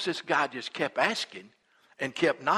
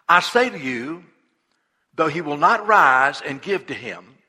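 weighty, emphatic Bible reading; scripture reading; 5 dB louder than usual. Acted. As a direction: proclaim the verse. Reads Jesus' words.

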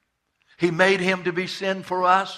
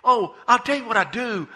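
usual speaking voice

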